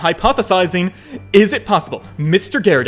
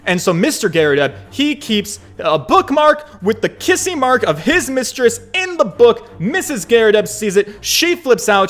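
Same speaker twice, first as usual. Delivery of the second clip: ordinary talk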